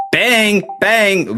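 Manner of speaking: high, triumphant bang bang; pumped-up cheer; excited cheer. Real, not acted. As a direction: celebrate the hit. Nailing a guess in the game.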